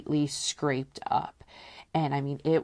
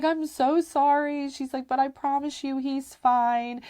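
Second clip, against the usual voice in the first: apologetic sitter; 10 semitones higher than usual. Retold or imitated. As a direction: soothe a crying mother. Sitter apologising to crying mother.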